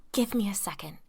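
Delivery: urgent unvoiced hiss; hissed whisper. A narrator's reading. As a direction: hiss it under your breath. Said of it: "he hissed".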